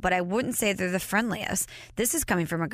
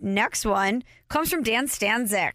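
ordinary delivery

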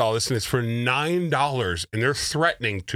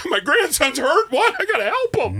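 high, gasping mock panic of a frightened grandparent; panicked grandma; 12 semitones higher than usual. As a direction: play the scam victim who gasps and frets, pitched up.

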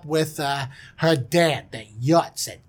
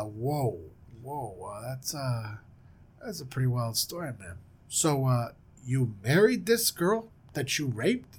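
laid-back dude; 5 semitones lower, 8 dB quieter than usual. Retold or imitated. Listener reacts to the story, low mellow dude. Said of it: "he goes".

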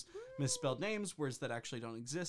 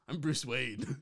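Batman stage voice; 3 semitones lower, slower than usual. Acted. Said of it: "my stage voice".

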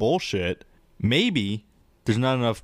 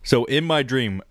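normal talking voice

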